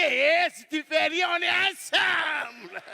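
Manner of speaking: playful shout to the gazelle, slow; mock shout to gazelle. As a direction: holler at the gazelle. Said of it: "Pour lui dire que".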